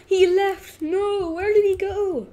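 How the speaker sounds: high gasping alarm, gamer losing his friend; shocked gasp. Real, not acted. Gamer panics as new friend leaves, high shocked cry. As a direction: pitch jumps up in sudden dismay.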